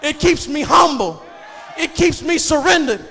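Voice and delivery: ordinary talk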